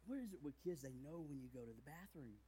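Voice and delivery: ordinary talk, nothing marked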